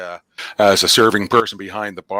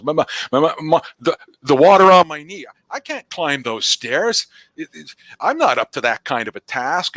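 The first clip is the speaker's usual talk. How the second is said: higher, stuttering excuses; stammering sheriff; 6 semitones higher than usual. Acted. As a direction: sheriff dodging the climb up the tower.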